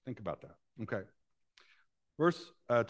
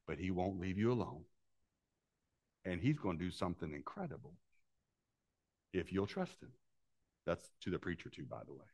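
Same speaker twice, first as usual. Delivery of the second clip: soft aside; 5 semitones lower, 10 dB quieter than usual. Real, not acted. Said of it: "preacher drops into a soft aside".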